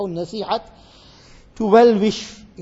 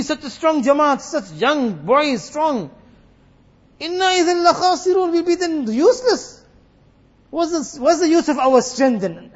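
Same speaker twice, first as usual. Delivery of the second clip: boasting brothers; 8 semitones higher, 6 dB louder than usual. Acted.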